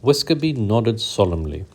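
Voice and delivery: ordinary delivery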